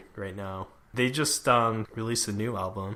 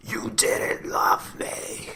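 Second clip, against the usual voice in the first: hushed, raspy imitation of a rock scream; mock scream; 3 dB quieter, much slower than usual. Imitating the Staind singer screaming for friends, hushed mock scream. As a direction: scream it under your breath, drawn out. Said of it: "scream it".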